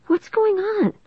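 high-pitched puzzled question; wondering. A narrator's reading. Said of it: "I wondered".

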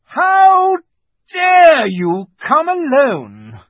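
high, loud imperious fury; wicked queen thundering slowly; terrible voice. A narrator's reading. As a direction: draw out each word in outraged rebuke. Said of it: "said the witch in a terrible voice".

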